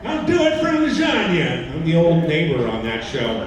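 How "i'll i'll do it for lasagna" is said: high, loud cartoon voice; quick cartoon character bit. Character line.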